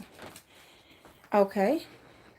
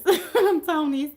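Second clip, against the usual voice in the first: laughing; 7 semitones higher, 5 dB louder, slower than usual. Real, not acted. Laughing repeat of comment.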